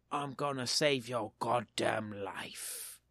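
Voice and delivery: low, slow Australian beaver voice; Australian accent. Acted. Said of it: "do a better Australian accent".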